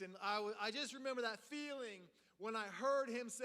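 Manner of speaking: ordinary delivery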